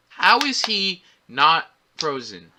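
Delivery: slow, word-by-word question; baffled emphasis. Real, not acted. Reviewer confused by the time freeze, slow baffled question. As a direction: spell it out slowly, pausing around "not".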